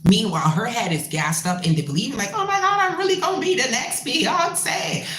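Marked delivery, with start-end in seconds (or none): 2.3-5.1 high-pitched gushing, imitating a deluded hopeful; mock starstruck excitement. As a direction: gush in a much higher voice, mockingly. Mimics starry-eyed singer's gassed-up belief.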